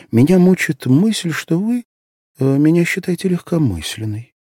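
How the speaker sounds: a lightly voiced anxious young girl; young girl's voice. A narrator's reading. Asya's voice in narration.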